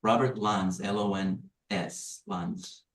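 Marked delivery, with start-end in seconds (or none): none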